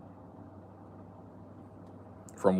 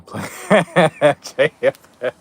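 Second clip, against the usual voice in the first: laughing; 6 semitones higher, 10 dB louder, much slower than usual. Real, not acted. Laughing at chat comment.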